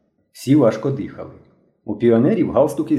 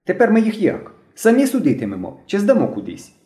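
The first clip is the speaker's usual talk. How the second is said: high-voiced boy asking in a businesslike way; 9 semitones higher than usual. A narrator's reading. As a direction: ask the leader what happens next, high-pitched. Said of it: "потім діловито спитав".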